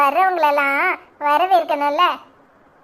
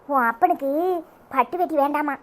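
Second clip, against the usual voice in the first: ordinary talk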